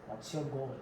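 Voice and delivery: ordinary talk, nothing marked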